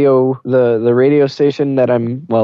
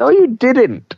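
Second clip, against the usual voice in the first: high, petulant comeback; childish retort; 12 semitones higher, slower than usual. Acted. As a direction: snap back like a kid. Childish bickering.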